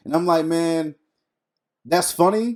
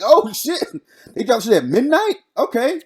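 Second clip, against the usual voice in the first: high-pitched excited fan reaction; 6 semitones higher than usual. Acted. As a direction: act out perking up at surprise news. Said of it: "you get excited".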